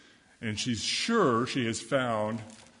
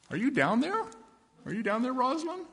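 much higher calling-down voice; calling father; 12 semitones higher than usual. Acted. Father calling down the hole to his daughter Rosalind. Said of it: "Her father comes along and says".

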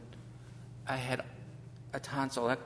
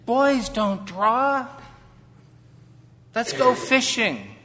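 put-on taunting boy; 7 semitones higher, 11 dB louder, slower than usual. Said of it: "He said".

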